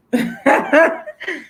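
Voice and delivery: high, bubbling burst of laughter; high-pitched giggle burst. Real, not acted. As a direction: break out laughing. Bursts out laughing before mock order.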